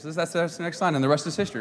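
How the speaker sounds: usual speaking voice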